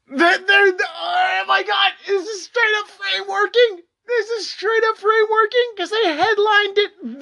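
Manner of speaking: high shouted disbelief; outraged shout. Real, not acted. Creator reacting to the headline, outraged shout. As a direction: yell it in outrage.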